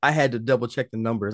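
ordinary delivery

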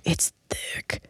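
low-volume whispered verdict; mock-confidential whisper; hushed whisper. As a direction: whisper it as if awestruck, imitating others. Hushed verdict on uncle's accent.

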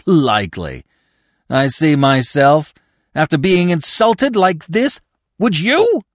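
scornful start rising high and loud; indignant demand. A narrator's reading. McTodd asking Smith to share his outrage, demanding. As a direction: demand agreement in climbing outrage. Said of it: "he demanded".

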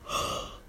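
a quick startled gasp; sharp gasp. Real, not acted. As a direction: catch your breath in surprise. Suddenly remembers something.

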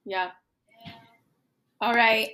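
usual speaking voice

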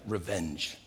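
low, very soft murmur of revenge; hushed urging. Acted. David's men urging him in the cave, low and hushed. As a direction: urge it darkly under the breath. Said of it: "David's men are like".

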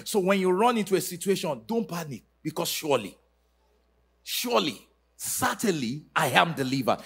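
ordinary talk, nothing marked

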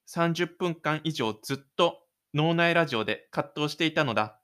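ordinary talk, nothing marked

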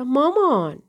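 indignant complaint to mother; protesting. A narrator's reading. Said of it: "با حالتی معترضانه گفتم".

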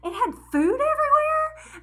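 giggly outrage at her own mix-up; squealing through laughter about the smelly car; laughing disbelief. Retold or imitated. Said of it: "and I'm like".

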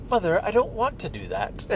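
put-on high-pitched little girl with a Peppa Pig accent; high, mock Peppa Pig accent. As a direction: imitate a granddaughter's cute Peppa Pig accent. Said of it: "it is a little Peppa Pig accent".

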